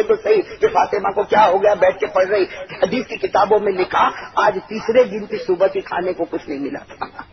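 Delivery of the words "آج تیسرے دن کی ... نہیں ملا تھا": ordinary talk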